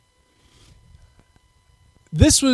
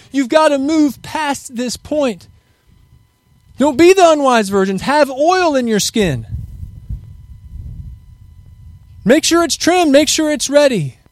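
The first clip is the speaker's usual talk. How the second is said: urgent preaching; 6 semitones higher, slower than usual. Acted. Urging the congregation, preaching hard.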